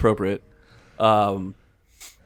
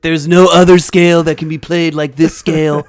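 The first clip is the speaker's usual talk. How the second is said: loud, higher-pitched rally voice; Donald Trump; 6 semitones higher, 11 dB louder than usual. Acted. Podcast host thanking B flat, as Trump at a rally. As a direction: proclaim it to a cheering rally crowd. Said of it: "Trump rally, uh, voice".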